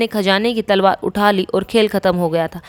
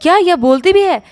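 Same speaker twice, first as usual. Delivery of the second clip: shocked; 7 semitones higher, 3 dB louder than usual. A narrator's reading. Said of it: "shock हो जाता है".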